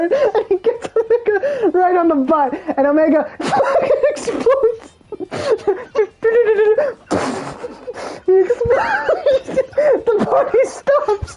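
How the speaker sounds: high, breaking up with real laughter; laughing while talking. Real, not acted. Storyteller cracking up as Omega explodes, laughing hard. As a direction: struggle to get it out through laughs.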